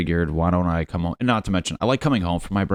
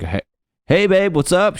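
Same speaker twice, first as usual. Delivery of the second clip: higher, louder, upbeat phone greeting; hammed-up cheerful boyfriend picking up; unsuspecting boyfriend; 12 semitones higher, 6 dB louder than usual. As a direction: chipper mock boyfriend, pitched up.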